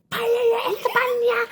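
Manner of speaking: high shouted mock Spanish accent; bad Spanish accent. Actor auditioning for Matias, shouted fake Spanish accent. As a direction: belt out a hammed-up Spanish accent. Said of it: "put on a Spanish accent".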